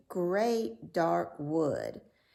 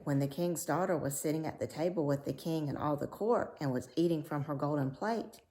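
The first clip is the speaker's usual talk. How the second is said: ordinary delivery, nothing marked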